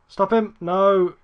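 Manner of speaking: urgent, high, loud cry at the players; alarmed shout. Real, not acted. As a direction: yell to stop the attack. Reacting to a dangerous counter-attack.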